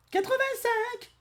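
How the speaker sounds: quatre-vingt-cinq in a very high, slow falsetto; girly falsetto. Pretend. Disguised Goku giving his chest size, squeaking quatre-vingt-cinq in falsetto.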